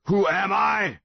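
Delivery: a giant roaring who it is; roaring monster. A narrator's reading. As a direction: bellow it down at the boy. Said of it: "still roaring".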